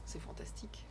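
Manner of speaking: real, barely voiced whisper; hushed admiration. Real, not acted. Moved reader reacting to the eleventh-century poem, hushed whisper. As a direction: let it out very softly, almost without voice.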